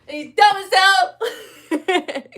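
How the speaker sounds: laughing while talking, high. Real, not acted. Streamer laughs at the diss, laughing hard. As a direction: crack up mid-sentence. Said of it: "laugh cry".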